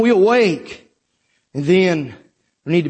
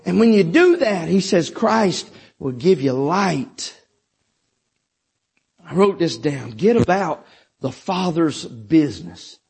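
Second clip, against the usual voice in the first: usual speaking voice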